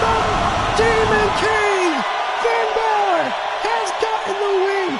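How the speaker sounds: high, shouting ringside call; frantic commentator yelling over the action; hyped play-by-play. Acted. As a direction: scream the big moves like a wrestling announcer. Calling the finish and win.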